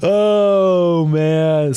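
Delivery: high, drawn-out amused sigh of words; amused exclamation. Real, not acted. Streamer recovering from laughing at chat, amused. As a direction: stretch it out high, coming off a laugh.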